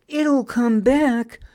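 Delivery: put-on high, loud child's assurance; confident assurance. A narrator's reading. Pun assures everyone the glow will return, insistent. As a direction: insist that all will be well. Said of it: "assured Pun".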